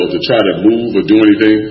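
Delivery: ordinary talk, nothing marked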